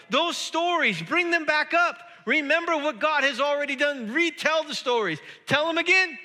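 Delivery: high, loud, driving exhortation; impassioned preaching. Real, not acted. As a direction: preach it, building to a shout. Preaches at full force.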